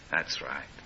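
a hushed, sorrowful mother's reply; sad mother. Retold or imitated. Preacher voicing his wife answering their son, hushed.

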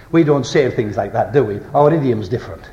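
ordinary delivery

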